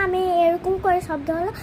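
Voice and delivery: normal talking voice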